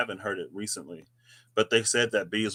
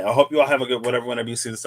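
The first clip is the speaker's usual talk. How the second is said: ordinary delivery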